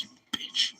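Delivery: hushed, voiceless mock curse; retold whispered exasperation; whispered retort. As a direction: whisper it under your breath. Muttered retort to squirming dog.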